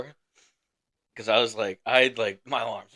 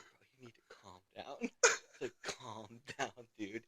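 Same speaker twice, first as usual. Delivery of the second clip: much softer, urgent aside; hushed calming; 3 semitones lower, 11 dB quieter than usual. Retold or imitated. Corporal talking down drunk sergeant at the desk, hushed. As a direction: quietly talk down a drunk buddy.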